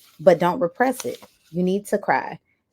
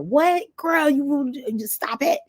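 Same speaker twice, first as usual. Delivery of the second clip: incredulous viewer; 9 semitones higher, slower than usual. Retold or imitated. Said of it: "be like".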